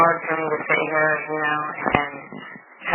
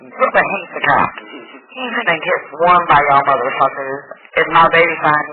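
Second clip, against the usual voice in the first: louder, fierce insistence; heated argument; 9 dB louder than usual. Retold or imitated. Mother arguing with the police about her baby, heated. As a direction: hammer every point home.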